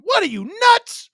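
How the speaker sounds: loud, high-pitched Philly accent. Shown as a demonstration. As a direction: burst out indignantly like a Philly local. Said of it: "can you do a Philly accent?".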